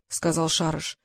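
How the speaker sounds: ordinary delivery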